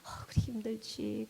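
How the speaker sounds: soft, slow, half-whispered comfort; tender, hushed acknowledgement; God sympathizing. Retold or imitated. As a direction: gently share in the hurt. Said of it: "다윗이 막 화가 나고 하면 하나님이".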